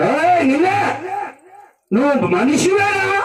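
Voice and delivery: high, drawn-out mock scolding; acted indignant rebuke.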